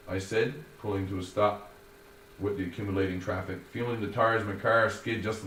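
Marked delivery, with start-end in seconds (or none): none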